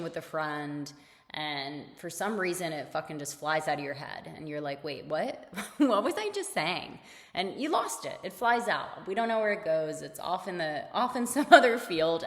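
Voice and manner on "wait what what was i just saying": ordinary delivery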